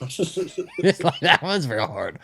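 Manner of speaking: talking through laughter; laughing. Real, not acted. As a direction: laugh while admitting it. Laughing after own accent attempt.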